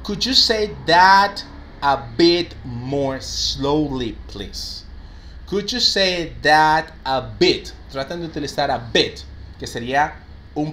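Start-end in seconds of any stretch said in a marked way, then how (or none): 0.0-4.8 slow, carefully spaced demo sentence; slow teacher model. Shown as a demonstration. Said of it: "La siguiente sería". 5.5-7.7 slow model phrase. Shown as a demonstration. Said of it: "La siguiente sería".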